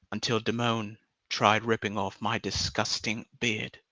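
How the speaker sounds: measured mock-poetic recital in an English accent. Streamer reading a mock farewell poem, put-on English accent. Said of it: "I'm gonna stop this accent now".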